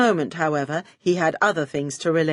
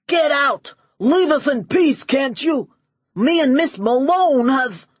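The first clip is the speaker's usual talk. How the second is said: high, loud lovestruck boss voice; impatient shout; 8 semitones higher, 5 dB louder than usual. Acted. Max ordering his henchman out, high loud yelling. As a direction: shove him out the door with your voice.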